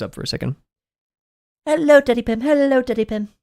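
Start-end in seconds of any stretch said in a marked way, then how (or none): 1.7-3.3 high put-on kid voice greeting; greeting a viewer in a high cartoon voice; squeaky character voice. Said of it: "greeting chat in the voice".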